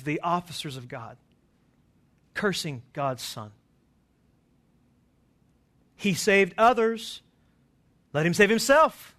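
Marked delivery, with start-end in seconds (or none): 6.0-9.1 mocking sneer. Preacher voicing rulers scoffing at Jesus, loud mocking jeer. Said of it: "There's such mockery in this".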